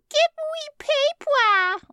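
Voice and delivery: put-on high squeaky toddler voice; kid voice. A narrator's reading. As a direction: pitch way up, slow and childish. Toddler character voice.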